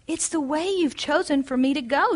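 usual speaking voice

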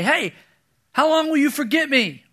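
a pointed, demanding question thrown upward; mock accusation. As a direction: call God out, half-playful.

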